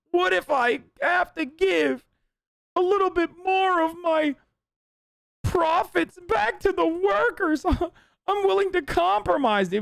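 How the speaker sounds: high, slow whiny mock voice; mocking the boss. Streamer mocks the crying restaurant boss in a high whine. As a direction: whine it like a pitiful boss, laughing at the end. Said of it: "He's like".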